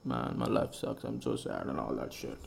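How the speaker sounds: low mopey voice, imitating his old self; mock sad self. Mimicking his past self, low mopey sulk. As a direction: drop low and moan it like a sulk. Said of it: "I used to be like".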